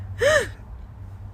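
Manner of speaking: sharp high intake of breath; mock-horrified gasp; shocked gasp. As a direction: gasp as if the price is a horror. Horrified at ambulance cost.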